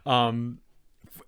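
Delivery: ordinary delivery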